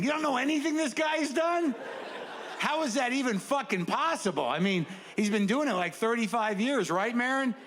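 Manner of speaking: high, pushy disbelief at his friends; a fan baffled nobody knows his hero; incredulous fan. Retold or imitated. Fan to his friends, high incredulous pushing.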